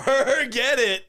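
slow, high mock brushoff; hammed-up boyfriend brushing it off. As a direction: drag out the dismissal in a put-on voice. Acted quote of boyfriend in story.

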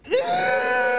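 very high, drawn-out acted scream; pained scream. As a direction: screech as if whacked on the nose. Acts scream as branch hits nose.

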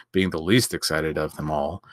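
plain reading voice

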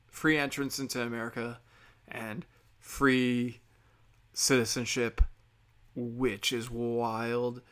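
ordinary delivery

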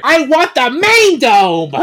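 high, loud and slow; villain queen yelling at her henchman; furious demand. Acted.

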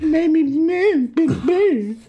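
put-on a high, slow whiny baby voice; childish whine.

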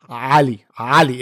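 ALI with a guttural, throat-deep opening A. Shown as a demonstration. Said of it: "It's a guttural A".

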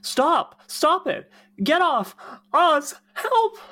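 high, loud frantic cries for help; panicked yelling. Acted. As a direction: swat at bugs and shriek for help. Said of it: "hears Willow yell for help".

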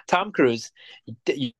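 usual speaking voice

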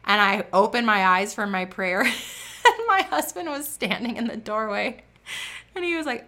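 usual speaking voice

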